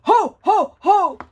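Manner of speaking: jolly Santa ho ho ho, loud; Santa laugh. Shown as a demonstration. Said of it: "Can you say".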